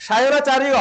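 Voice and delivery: সায়নাচার্য ending in "-chariyo"; Sanskrit pronunciation. Shown as a demonstration. Teacher shows the class the Sanskrit form, Sanskrit pronunciation. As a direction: say সায়নাচার্য with a "riyo" ending, not "rjo". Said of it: "অন্তঃস্থীয় জ থাকলে আমি কি উচ্চারণ করতে বলছি".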